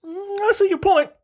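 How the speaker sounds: high-pitched imitation of a god conceding; put-on high voice, mulling it over; Panther God. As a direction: voice a persuaded deity, pitched well up. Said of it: "the Panther God was like".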